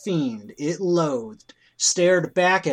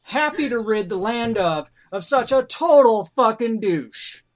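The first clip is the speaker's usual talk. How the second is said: scornful ranting; 7 semitones higher, 4 dB louder than usual. A narrator's reading. Narrator mocking Trump-like target.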